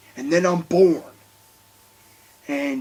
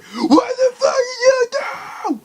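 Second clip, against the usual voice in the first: mock furious yell, much higher and slow; imitated yell; 5 dB louder, slower than usual. Vlogger imitating the prank dad yelling at his son, mock furious yell. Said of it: "yell at him and be like".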